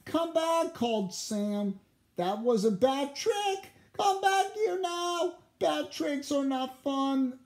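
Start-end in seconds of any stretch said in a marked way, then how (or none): 0.1-0.7 high, loud calling out. A narrator's reading. Sam calls after Gus. 2.2-7.4 high scolding voice; scolding Sam. A narrator's reading.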